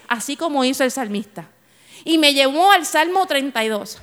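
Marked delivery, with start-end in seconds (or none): none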